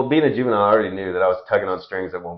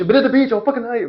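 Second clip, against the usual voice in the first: much higher, strained mock yell; screaming at dad; 4 dB louder, faster than usual. Son yelling at his dad by the Jeep, much higher mock yell. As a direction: scream it like a furious teen. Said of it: "I was like".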